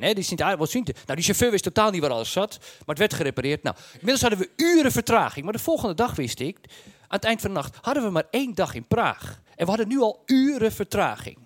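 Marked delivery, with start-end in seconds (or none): none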